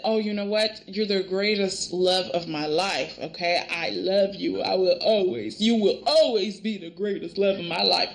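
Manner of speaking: low, hushed romantic avowal; tender love declaration. Retold or imitated. Recapping Brooke's words to Bill, low and tender.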